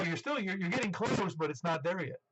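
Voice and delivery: normal talking voice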